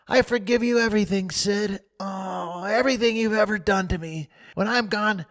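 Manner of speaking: put-on high and fast, melodramatic dying boy; mock deathbed farewell. Tom forgiving Sid as if dying, melodramatic. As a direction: play the martyr, gushing.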